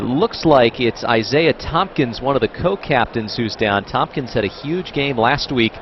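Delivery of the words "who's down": usual speaking voice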